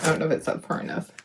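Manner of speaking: fast whisper to herself; whispered aside. Real, not acted. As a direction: mutter the doubt under her breath quickly. Doubting under her breath.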